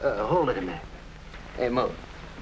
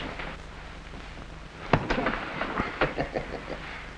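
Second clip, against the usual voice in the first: long wheezing chuckle; 6 dB quieter than usual. Acted. Flat Top enjoys henchman's joke.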